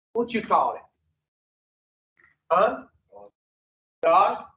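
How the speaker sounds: ordinary talk, nothing marked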